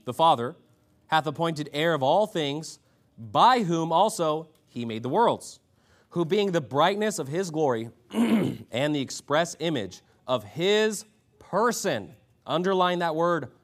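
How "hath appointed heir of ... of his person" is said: slow, raised verse reading; measured, ringing Bible recitation; emphatic scripture reading. A narrator's reading. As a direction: proclaim each phrase deliberately, pitched up. Reads scripture aloud to congregation.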